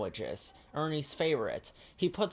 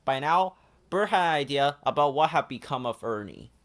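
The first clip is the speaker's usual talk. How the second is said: ordinary delivery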